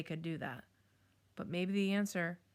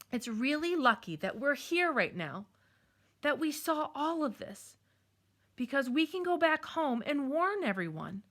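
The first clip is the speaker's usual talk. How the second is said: higher, louder upbeat urging; rally her with an idea; eager; 6 semitones higher, 4 dB louder than usual. Acted. Jed rallies with sudden plan.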